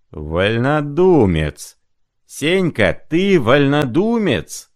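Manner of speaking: slow, high accusing shout. Acted. Zimoveykin denouncing Senka, slow high shout. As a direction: drag out the accusation loudly.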